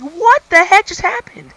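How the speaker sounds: shocked disbelief. Retold or imitated. Watching a stranger get slapped, high shocked disbelief. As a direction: gasp it out in total shock. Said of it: "I'm like".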